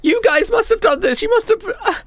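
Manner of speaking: put-on very high-pitched confused kid; small kid demanding answers; bewildered child. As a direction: plead in a high little child's voice.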